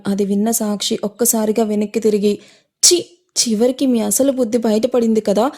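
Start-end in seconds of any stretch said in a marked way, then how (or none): none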